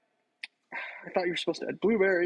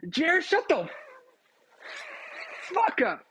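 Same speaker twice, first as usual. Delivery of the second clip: yelling at friend; 13 semitones higher, slower than usual. Real, not acted. Yelling at friend Jared to shut up, shouted high. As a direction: tell Jared off at full pitch.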